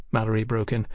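usual speaking voice

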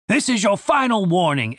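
put-on guard voice, stern; a touch of menace in the soldier; lightly voiced final warning. A narrator's reading. Soldier warns Julian.

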